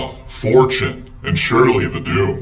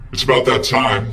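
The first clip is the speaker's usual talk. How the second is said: usual speaking voice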